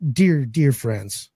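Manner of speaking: usual speaking voice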